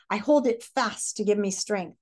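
ordinary delivery, nothing marked

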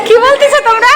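amused laughing; laughing question. Real, not acted. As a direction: ask while still laughing. Teacher laughing at kids.

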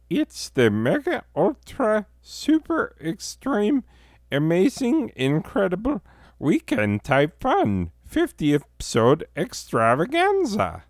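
a tiny squeaky voice where a big one was asked; weak high announcer voice. Acted. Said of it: "[name], step up to the microphone".

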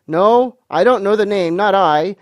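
high, loud thinking out loud; running on aloud. A narrator's reading. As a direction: search the memory for all the room to hear. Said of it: "he ran on again aloud".

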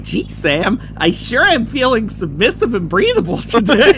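high, excited cartoon voice; cartoon Max voice. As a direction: squeak it out like an eager cartoon sidekick. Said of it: "Max is all like".

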